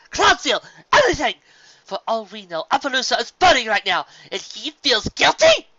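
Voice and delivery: high, loud, raging shout; outraged yelling rant; furious tirade. A narrator's reading. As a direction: rant at her sister in fury. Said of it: "Luna stammered in rage".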